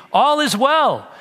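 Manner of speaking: high, loud cry of All is well; cried out. A narrator's reading. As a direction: call out to the king, high and loud. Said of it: "Ahimaaz cried out to the king".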